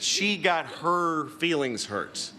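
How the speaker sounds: "She got her feelings hurt" slow, each word set apart; "She" and "her" drawn out in mock disbelief; incredulous quoting. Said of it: "Such a weird way to phrase it".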